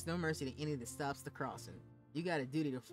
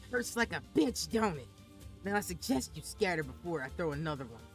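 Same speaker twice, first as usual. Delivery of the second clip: put-on loud, raging old woman's voice; thick rage; 2 semitones higher, 5 dB louder than usual. Grandmother threatening the village children, loud furious old woman. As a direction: snarl threats at the brats. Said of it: "in a voice with thick rage".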